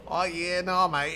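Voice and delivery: Australian accent demo from the throat. Shown as a demonstration. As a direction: say it back in the throat, Aussie style. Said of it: "set your jaw back".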